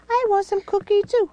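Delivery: high, fast little-creature voice; high squeaky toy voice. Pretend.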